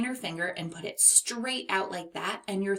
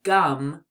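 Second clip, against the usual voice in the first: gum said louder as a whole; gum stressed; 3 semitones lower, 6 dB louder than usual. Shown as a demonstration. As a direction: punch the word gum. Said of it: "the word gum".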